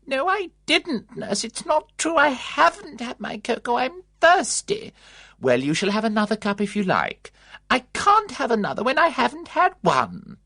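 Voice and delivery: very high, loud fretful demand; loud shrill old woman. A narrator's reading. Old resident calling down the passage for cocoa, shrill and loud. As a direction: cry out for it like a fussy old lady.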